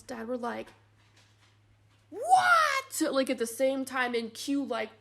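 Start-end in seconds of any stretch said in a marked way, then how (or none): 2.1-3.1 high, loud shocked yelp; shocked what. Retold or imitated. Grandparents hearing the baby's weight, loud high shock. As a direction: blurt it out in sheer shock. Mom and dad react together.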